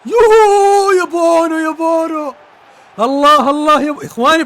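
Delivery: high, loud drawn-out whoop; ecstatic cheer. Real, not acted. Streamer whooping at another save by the keeper, ecstatic. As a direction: whoop slowly and loudly in joy.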